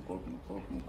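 quiet, gentle soothing of a scared dog; soft reassurance. Real, not acted. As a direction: hush the dog with soft repeats. Vet calming a frightened dog.

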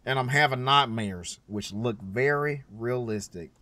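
put-on low, slow country accent; hushed country-accent storytelling. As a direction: draw it out in a country twang. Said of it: "I'm sorry for the country accent".

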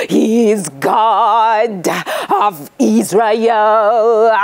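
a pastor rehearsing his sermon, preaching slowly. A narrator's reading. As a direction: call it out like a pulpit sermon. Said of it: "rehearsing for his sermon".

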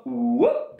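rising whoop as a pretend trumpet; goofy elephant whoop; silly elephant call. Shown as a demonstration. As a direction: swoop upward like a very unconvincing elephant. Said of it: "Elephants don't sound like that".

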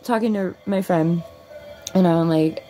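ordinary talk, nothing marked

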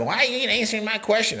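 high mock-whiny viewer voice; impatient viewer. Host imitating a pushy viewer demanding answers. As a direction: needle him like a pushy fan.

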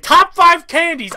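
loud, slow, high countdown call; hyped announcement. Real, not acted. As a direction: announce it like a game show host. Loud hyped candy countdown intro.

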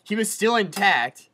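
loud, high, worked-up retelling; excited and loud. Real, not acted. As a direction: tell it all fired up. Excitedly explaining the smash.

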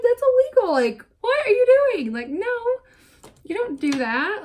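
high, loud indignant scolding; mock outrage. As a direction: scold like the litter police. Retold scolding as litter police.

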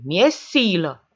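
slow, drawn-out roar of "Mi-e silă!" with "mie" stressed. A narrator's reading. Iacobescu stamps on the glass shards and roars "Mi-e silă!" slowly. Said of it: "răcni".

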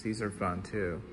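ordinary delivery

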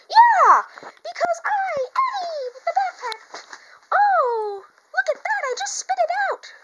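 very high, squealing puppet voice; Eddie the Backpack. Pretend. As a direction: burst out in a squeaky, loud character voice. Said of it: "Eddie the Backpack, come to life".

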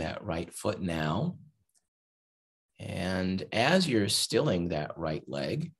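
unhurried body-scan guidance with long pauses; guided meditation. Acted. Teacher guiding listeners to relax hand and leg, guided meditation. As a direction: lead them gently through relaxing, phrase by phrase.

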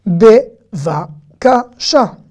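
Bevakasha said very slowly; slow model word. Shown as a demonstration. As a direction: spell it out by sound.

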